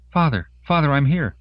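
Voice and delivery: put-on high, loud girl's answer; calling back. A narrator's reading. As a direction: call out eagerly to be found. Daughter calls back to father.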